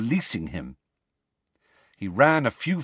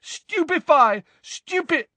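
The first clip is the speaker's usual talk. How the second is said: high yelled incantation, cut off; shouted spell; 3 dB louder than usual. A narrator's reading. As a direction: yell the spell repeatedly, break off midway. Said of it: "Neville shouted again".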